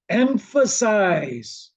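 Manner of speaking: EMPHASIZE stretched out long and high; emphatic. Shown as a demonstration. Teacher urging trainees not to rush, EMPHASIZE drawn out. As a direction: draw out EMPHASIZE with a falling close. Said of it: "How you emphasize?".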